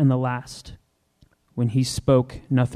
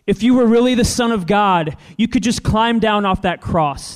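higher, louder sneering challenge; taunting crowd; 7 semitones higher, 5 dB louder than usual. Acted. The crowd taunts Jesus to prove he is God's son. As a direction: dare him to climb down. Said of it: "they said".

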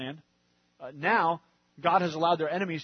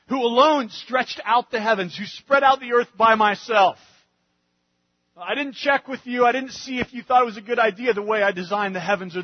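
louder, rising preacher voice speaking as God; preaching at full force; 4 semitones higher, 10 dB louder than usual. Acted. Preacher speaking as God to the congregation, louder and fervent. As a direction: proclaim God's eternity over the congregation.

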